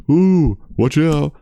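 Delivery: high, slow mock warning; a hammed-up high ooh of warning; mocking audience. Mocking moviegoers with a high slow warning. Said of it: "they'd be like".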